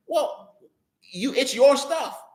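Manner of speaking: high, slow, pointed sarcasm; sarcastic jab. Real, not acted. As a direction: drag it out to rub it in. Mocking Saul's useless gear.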